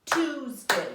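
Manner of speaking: loud, split as Tues-day; Tuesday split into two loud clapped beats; clapping syllables. Shown as a demonstration. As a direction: clap out each beat of Tuesday loudly. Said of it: "Do it again".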